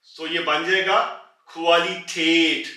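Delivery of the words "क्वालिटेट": क्वालिटेट drawn out slowly; slow model word. Shown as a demonstration. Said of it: "इसकी आवाज को वो flat कर देगा". (क्वालिटेट धीरे-धीरे, हर आवाज़ साफ़)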